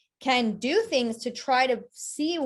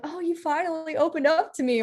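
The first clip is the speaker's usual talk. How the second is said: pleased surprise; 7 semitones higher than usual. Retold or imitated. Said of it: "some people like".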